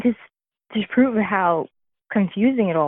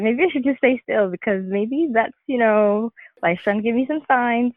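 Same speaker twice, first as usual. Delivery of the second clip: higher-pitched joking self-quote; 6 semitones higher than usual. Retold or imitated. Widow retelling her joke about staying still, joking. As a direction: lightly toss it out as a joke. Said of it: "I said it jokingly".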